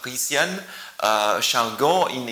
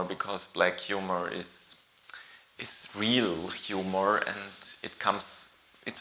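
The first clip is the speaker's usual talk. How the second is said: whisper; 3 semitones lower, 8 dB quieter than usual. Real, not acted.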